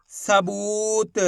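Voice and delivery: सबूत read very slowly, बू held long; drawn-out long ू. Shown as a demonstration. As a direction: stretch the "oo" in सबूत. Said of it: "खींच के पढ़ेंगे".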